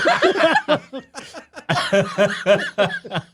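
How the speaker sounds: long high laugh. Real, not acted. Cracking up with co-hosts at his joke, high laughter.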